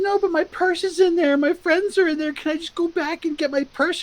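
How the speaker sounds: very high-pitched imitation of a girl begging; pleading girl. Girl begging bouncer to get her purse, high pleading. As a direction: plead in a high put-on girl's voice.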